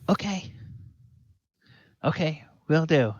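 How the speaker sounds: put-on high, keen kid voice; small boy taking orders; obedient young boy. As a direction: chirp agreement like an eager lad. Boy agrees to lead the way.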